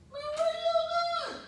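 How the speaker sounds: very high, loud mock shriek; retold startled shriek. Man woken by a slap to his partner, startled high shriek. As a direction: squeal it as if slapped awake.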